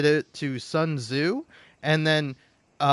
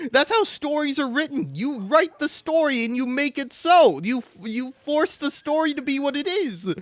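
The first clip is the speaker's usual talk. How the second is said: higher, lecturing scorn; exasperated rant; 9 semitones higher than usual. Acted. Still lecturing the plot critics, scornful and high. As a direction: spell it out to an idiot from way up high.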